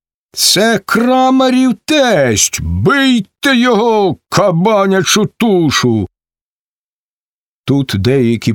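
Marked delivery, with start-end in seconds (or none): 0.3-6.1 high, slow yelled order; furious shout. A narrator's reading. Ataman orders to beat Cherevan, high shout. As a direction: set the men on him. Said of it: "закричав отаман".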